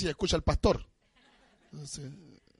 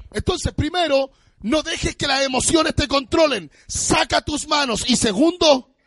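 climbing higher and louder into a sung preach; rising preaching; 9 semitones higher, 6 dB louder than usual. Acted. Pastor warning the church about emotions, climbing and sung. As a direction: build the sermon point to a peak.

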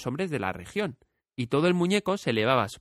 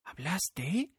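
put-on higher, softer, amazed little girl; astonished girl; 5 semitones higher, 7 dB quieter than usual. A narrator's reading. Dorothy asks the talking scarecrow, amazed. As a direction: breathe the question in wonder. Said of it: "preguntó la niña muy extrañada".

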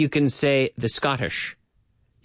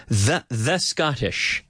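slow demo; 3 semitones lower, slower than usual. Shown as a demonstration. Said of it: "we say".

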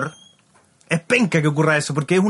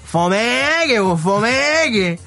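drawled, higher slangy reply; street lowlife; 4 semitones higher, much slower than usual. Acted. Fare dodger answering the TV reporter, slangy drawl. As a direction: drag the slang out like a street punk. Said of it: "el weón Kuma".

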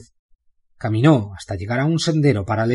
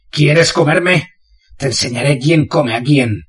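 slow, louder growled challenge to a shark; menacing taunt; 3 semitones higher, 4 dB louder than usual. Acted. Bane taunting the shark he is killing, slow and menacing. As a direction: drag out the first threat, then bite the rest.

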